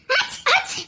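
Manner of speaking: a high, hammed-up "ஆச், ஆச்" sneeze; fake sneeze. Aunt faking a sneeze to match the niece's. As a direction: fake a sneeze to cover the other one.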